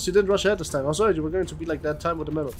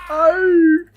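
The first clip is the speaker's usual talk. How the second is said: sudden high, loud scream of pain; 13 semitones higher, 10 dB louder than usual. Acted. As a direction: shriek as the arm is hacked off. Man's arm is cut off.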